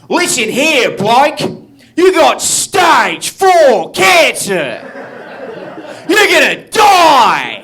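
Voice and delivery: loud, high, slow hammed-up Australian shout; Australian accent. Australian doctor giving cancer news, loud hammed-up Australian. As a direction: bellow it like a cheerful Australian. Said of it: "he was Australian".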